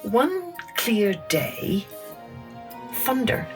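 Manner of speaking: plain reading voice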